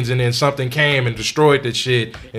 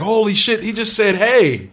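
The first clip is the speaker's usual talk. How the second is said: high, loud, thrilled exclamation; amazed outburst; 9 semitones higher, 6 dB louder than usual. Retold or imitated. Gamer recalling first hearing a character talk, acting out astonishment. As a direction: marvel loudly at a tiny thing.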